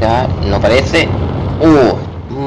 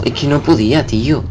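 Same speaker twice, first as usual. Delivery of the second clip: usual speaking voice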